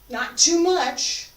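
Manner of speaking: high, loud, slow "not too much"; emphatic warning. Real, not acted. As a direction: call out the correction firmly. Teacher corrects a student's posture.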